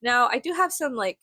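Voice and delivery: ordinary talk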